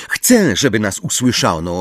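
usual speaking voice

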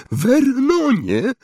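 high, slow anxious call; trembling voice. A narrator's reading. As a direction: say the name in rising dread. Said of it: "mówiła ciotka Petunia rozdygotanym głosem". (wysoki, rozdygotany głos)

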